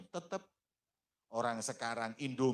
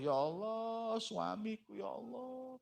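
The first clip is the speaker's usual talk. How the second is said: high, soft crying lament; weeping; 12 semitones higher, 3 dB quieter than usual. Acted. Masyitoh weeping over her burned husband, high and soft. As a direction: cry out to God in grief. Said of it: "Nangis Mas Ito".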